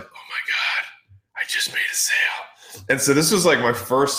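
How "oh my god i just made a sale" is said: breathless whispered disbelief; amazed whisper. Retold or imitated. New salesman after his first supplement sale, awed whisper. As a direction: whisper it to yourself in awe.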